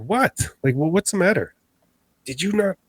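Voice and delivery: high-pitched retold back-and-forth; acting out a tense, incredulous exchange; exasperated questioning. Two friends at odds over a noise, exasperated questioning.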